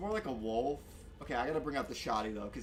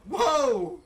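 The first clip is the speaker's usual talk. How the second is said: high, loud shocked whoa; startled whoa; 14 dB louder than usual. Real, not acted. Gamer caught off guard by the wolf, loud whoa. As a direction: jump at the surprise. Surprised by enemy attack.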